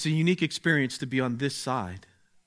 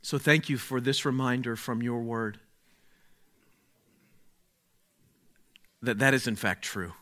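prayer. Acted. Leads a prayer.

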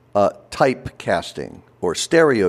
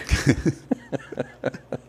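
laugh; 6 dB quieter than usual. Real, not acted. Laughs at own story.

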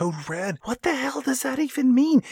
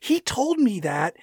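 normal talking voice

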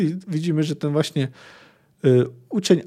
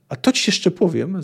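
plain reading voice

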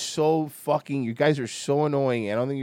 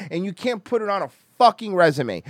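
normal talking voice

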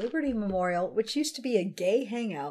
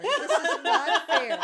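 higher, squealing through laughter; laughing protest; 7 semitones higher, slower than usual. Real, not acted. Laughing at the friends over Gibraltar. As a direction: wail it while cracking up.

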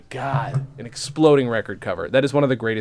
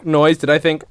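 normal talking voice

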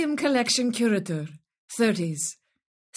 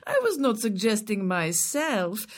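ordinary delivery

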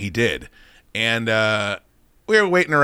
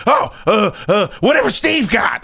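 mock flustered Ant-Man; 7 semitones higher than usual. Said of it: "He goes".

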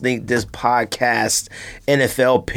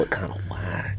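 whisper; 4 semitones lower, 11 dB quieter than usual. Real, not acted. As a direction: drop to a whisper for the dig. Whispered dig at the Eagles.

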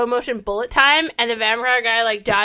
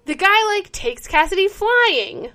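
ordinary delivery

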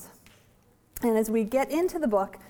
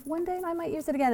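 quick hopeful thought; 8 semitones higher, faster than usual. Retold or imitated. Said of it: "and I just think".

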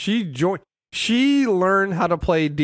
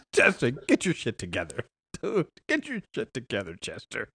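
muttered scolding; 7 semitones lower, 8 dB quieter than usual. Acted. Scolding the character.

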